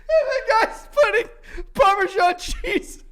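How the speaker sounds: slow, high, laugh-choked delivery; helpless laughter on the punchline; laughing while talking. Real, not acted. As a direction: drag it out high through laughter. Cracking up at the punchline.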